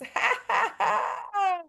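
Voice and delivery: a bright high burst of laughter; real laugh. Real, not acted. Laughs at students' attempts.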